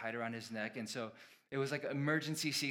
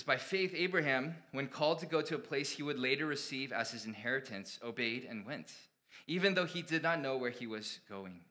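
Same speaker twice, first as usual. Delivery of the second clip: scripture reading; 5 dB louder than usual. Acted. Pastor reads Hebrews to his congregation, raised proclaiming voice.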